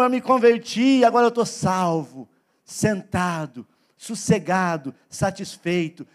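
mock self-satisfied settling down, pitch sinking; complacent believer. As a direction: draw out each word like a comfortably idle believer. Imita o crente acomodado. (crente acomodado, fala arrastada)

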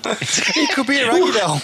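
high, slow words through laughter; laughing. Real, not acted. Teasing a mate about the reviewer's name, laughing. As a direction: crack up while saying it.

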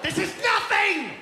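loud, fast whining shout, rising high at the end; a spoiled kid yelling for TV; bratty kid yelling; whiny kid yell. Retold or imitated. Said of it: "And the kid's like".

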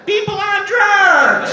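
shouted reveal to the crowd; punchline shout. Real, not acted. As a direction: bellow the punchline. Punchline of the bit.

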